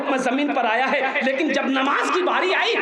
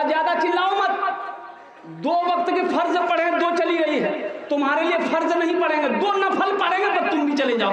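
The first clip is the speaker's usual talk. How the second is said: higher-pitched, acted irritation; angry outburst; 6 semitones higher than usual. Buffalo owner scolding the bawling calf, acted anger. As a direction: scold in a raised, fed-up voice. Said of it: "तो इसको गुस्सा आया".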